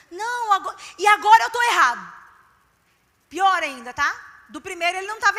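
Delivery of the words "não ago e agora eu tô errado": 1 indignant husband. Retold or imitated. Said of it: "husband's protest retold".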